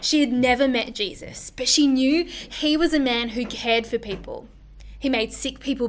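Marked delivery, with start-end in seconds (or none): none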